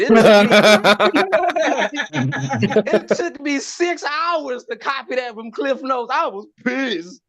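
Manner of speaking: cracking up mid-line, slowed; comic laughing at own plagiarism story; laughing through it. Real, not acted. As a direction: laugh while telling it.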